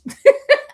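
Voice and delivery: high cackling burst; loud, high laughing shriek; loud shriek of laughter. Real, not acted. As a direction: howl with laughter. Laughs at own insult.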